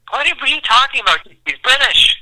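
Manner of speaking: high, put-on British voice; squeaky fake British accent; mock British accent. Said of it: "That's your British?".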